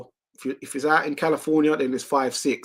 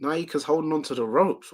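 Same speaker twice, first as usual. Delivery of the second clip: normal talking voice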